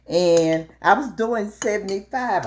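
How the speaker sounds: usual speaking voice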